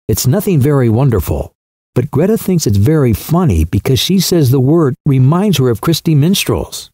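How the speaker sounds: usual speaking voice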